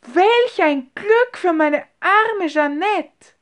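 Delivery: high, loud, slow cry of delight; elated outburst, drawn out and soaring; joyful exclamation. A narrator's reading. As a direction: rejoice out loud for a friend. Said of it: "rief sie aus".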